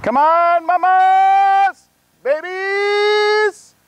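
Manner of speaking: high, loud yelling call across the field; calling out to goats. Real, not acted. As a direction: holler to call the herd in. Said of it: "I'm gonna yell out".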